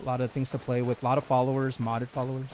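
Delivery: usual speaking voice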